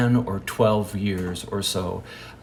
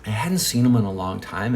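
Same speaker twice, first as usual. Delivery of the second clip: ordinary talk, nothing marked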